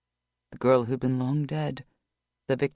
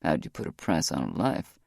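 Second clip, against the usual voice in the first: lower, steady male voice; Rock's voice; 10 semitones lower than usual. A narrator's reading. Rock's bitter question.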